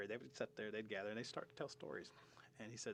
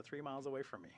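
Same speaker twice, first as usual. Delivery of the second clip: usual speaking voice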